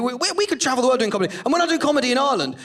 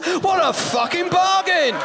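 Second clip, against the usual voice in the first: sarcastic shout; 5 semitones higher, slower than usual. Real, not acted. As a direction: hurl the word bargain at them with scorn. Sarcastic rant climax, shouting.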